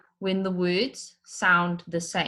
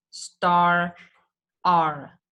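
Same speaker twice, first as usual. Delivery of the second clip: star and R, louder and separate; rhyming words said apart; 4 dB louder than usual. Shown as a demonstration. Teacher contrasting the two rhyming words. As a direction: say each rhyme word alone. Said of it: "say those two words separately".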